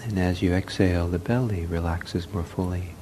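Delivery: soft, unhurried meditation voice with long pauses; guided meditation. Real, not acted. Leads a breathing meditation.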